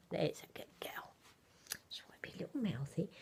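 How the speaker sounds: soft whisper. Real, not acted. Mother whispering fondly beside the baby doll.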